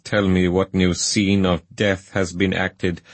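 ordinary delivery, nothing marked

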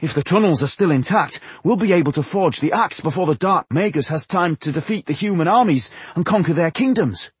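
high-pitched, eager rush of hope; excited. A narrator's reading. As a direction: carry the excitement through to the end. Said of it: "Tungdil cried excitedly".

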